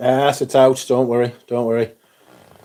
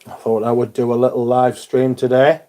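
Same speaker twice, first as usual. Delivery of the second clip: talking while chewing gum. Real, not acted. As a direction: speak around a piece of gum. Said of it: "I've got some chewing gum in".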